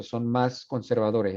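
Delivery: ordinary delivery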